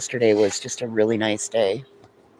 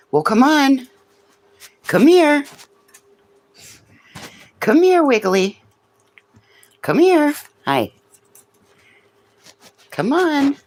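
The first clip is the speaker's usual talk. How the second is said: high-pitched call to the dog; 5 dB louder than usual. Real, not acted. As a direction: coax the dog over, high and loud. Calling the dogs over.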